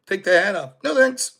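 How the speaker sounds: normal talking voice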